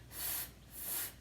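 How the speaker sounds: "F, f" as a voiceless hissed ffff with no vowel; f sound. Shown as a demonstration. Teacher showing kids the f sound, voiceless. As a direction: breathe out a long unvoiced "f". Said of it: "it makes the f, f sound".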